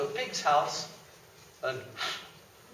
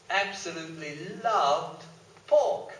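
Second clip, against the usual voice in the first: ordinary delivery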